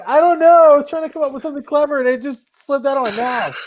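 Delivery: high-pitched, loud start then rushed excuse; flustered mom. Retold or imitated. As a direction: imitate mom protesting in a high voice. Acting out mom's flustered excuse.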